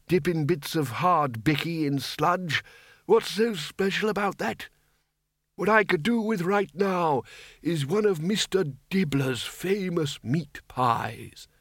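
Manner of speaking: put-on high, querulous old codger, slowing and fading at the end; rambling old man. A narrator's reading. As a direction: ramble on high-pitched, then slowly run down. Voices old Windle rambling.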